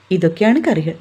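plain reading voice

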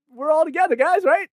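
high-pitched fake all-in-it-together plea; hammed-up, high chummy appeal; mock chummy. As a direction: play someone pleading false solidarity.

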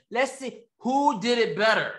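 ordinary delivery

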